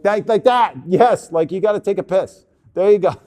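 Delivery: loud burst of praise; excited approval. Real, not acted. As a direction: cheer as they finally get it. Model finally gets the pose.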